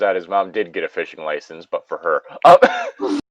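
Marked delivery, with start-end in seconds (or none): 2.4-3.2 a high, louder startled oh; high surprised oh; sudden surprise. Real, not acted. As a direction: jump up in pitch, caught off guard. Realizes mom's own license.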